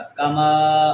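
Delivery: کما with the last aa drawn out; slow, low stretch on the end of کما; long final vowel. Shown as a demonstration. Teacher models alif after meem, کما lengthened. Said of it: "تھوڑا سا لمبا کریں گے".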